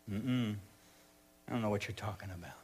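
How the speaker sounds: low, very soft brush-off; quiet denial. Acted. Peter denying Jesus to a little girl, quiet and evasive. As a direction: deny it under the breath.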